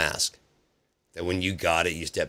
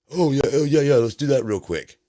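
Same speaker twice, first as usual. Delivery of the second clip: higher, louder, hurried; mock flustered Trump; 7 semitones higher, 7 dB louder than usual. President suddenly remembering the signing, flustered and hammed-up. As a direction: blurt it out like a caught-out boss.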